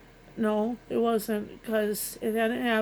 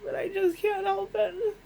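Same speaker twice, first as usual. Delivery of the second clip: high, tearful, can't hold it back; real crying, voice breaking; ugly crying; 9 semitones higher than usual. Real, not acted. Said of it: "Sorry for the ugly crying".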